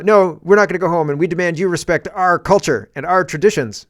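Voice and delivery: defiant migrant refusing to go home; defiant shout. Pretend. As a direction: shout back that you won't leave.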